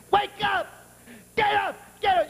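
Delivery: high yelled wake-up call; wife yelling wake up. Retold or imitated. Wife waking him, kicking bed.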